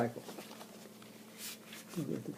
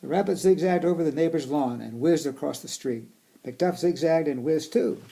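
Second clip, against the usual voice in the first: storybook reading voice; reading to children; 8 dB louder than usual. Acted. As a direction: read it aloud to kids. Reading picture book aloud.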